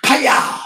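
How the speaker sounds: sharp high yelp of pain; mock pained chop yell. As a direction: cry out like a chop just landed. Pain cry at chest chop.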